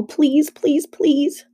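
high, urgent repeated please; will it on, each please pitched high; pleading. A narrator's reading. Mother desperate to reach safety, pleading. Mother willing the car onward.

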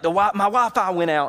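keyed-up mimicked phone report; excited. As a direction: imitate an excited friend bursting with news. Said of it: "He's so excited".

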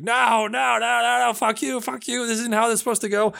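mock-hysterical villain, racing at the end; panicked Riddler. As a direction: squeal it like his plan is collapsing. Riddler melts down when rejected.

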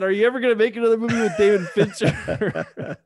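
high, loud put-on voice broken by laughter; retelling the tease between laughs; mocking question, laughing. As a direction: repeat the jab while laughing. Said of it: "kinda making fun of him".

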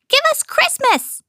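very high squeaky troll shout. A narrator's reading. Trolls shouting back, high and squeaky. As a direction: yell yes like an eager troll. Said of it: "they shouted".

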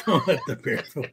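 real laughing echo of a joke. Real, not acted. Listener echoes the comic's punchline, laughing. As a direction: repeat the punchline through laughter.

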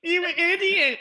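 put-on high, loud, slow drunken shout at the guards; drunken yell. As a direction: yell it slurred and furious. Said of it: "obviously full of drink".

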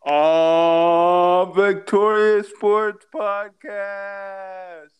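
slow, booming announcer intro; hype intro. Acted. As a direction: boom it out like a wrestling entrance. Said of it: "bless us with that New Day intro".

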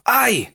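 sharp high yelp as if burned; Spanish ay of pain; pained ouch. Shown as a demonstration. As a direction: cry out like touching a hot stove. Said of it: "como si nos pegáramos un quemón con la estufa".

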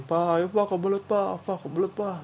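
mock urgent kid begging at the door; pestering kid. Kid teasing dad through bathroom door, mock urgent. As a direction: pretend you badly need the toilet. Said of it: "Gue isengin".

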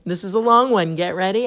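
normal talking voice

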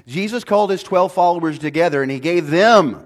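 loud, high, driving sermon voice; preaching at full force. Acted. Pastor to congregation, loud, passionate preaching. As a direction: hammer it home to the congregation.

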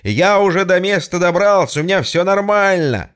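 loud, high happy call down the line; joyful shout. Acted. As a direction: shout the good news. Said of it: "радостно так кричу". (радостный крик в трубку)

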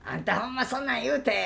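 high teasing Osaka auntie. Retold or imitated. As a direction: tease like a nosy Osaka auntie. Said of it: "みたいな感じで".

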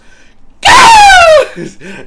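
very high, very loud screeching cry; eagle screech. Retold or imitated. Scaring mates at the campfire with a very loud eagle screech. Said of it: "doing the fucking eagle".

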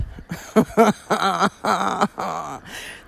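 long loose run of laughter; laughing. Real, not acted. Hiker amused by her breakfast outburst, laughing.

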